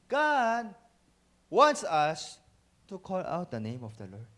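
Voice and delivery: normal talking voice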